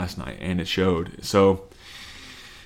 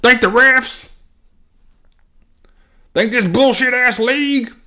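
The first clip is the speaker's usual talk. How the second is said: mocking Mahomes impression; 6 dB louder than usual. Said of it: "He's like".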